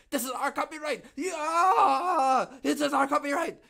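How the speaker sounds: high, loud caricature of a protective company; mock Japanese exec. Mocking Japanese companies guarding copyright, loud and high. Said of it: "They're like".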